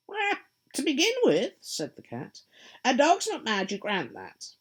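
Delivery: high, loud, sly grinning cat voice; a teasing cat, pleased with itself; Cheshire Cat. Acted. The cat answering lost Alice, high and sly.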